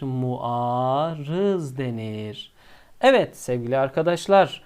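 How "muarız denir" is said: very slow low "Muarız"; slow emphatic "Muarız"; slow answer. Shown as a demonstration. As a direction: draw out the answer for the class. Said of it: "ne denir".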